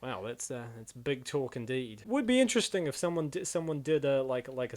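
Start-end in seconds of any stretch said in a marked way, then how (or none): none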